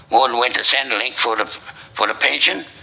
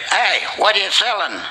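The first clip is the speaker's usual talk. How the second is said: retold yell; 9 semitones higher than usual. Bloke banging on the shop window, mock yelling. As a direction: call out in a high voice, half-shouted. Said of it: "He yelled out".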